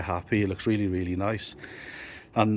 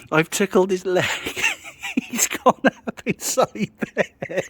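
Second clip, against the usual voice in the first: laughing; 10 semitones higher, 3 dB louder than usual. Real, not acted. Laughing retelling the tickle.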